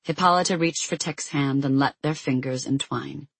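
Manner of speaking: ordinary delivery, nothing marked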